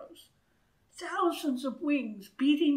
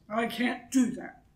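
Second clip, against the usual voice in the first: a fairly loud protest from the king; crying out; 7 dB louder than usual. A narrator's reading. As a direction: protest it out, louder. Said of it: "cried the king".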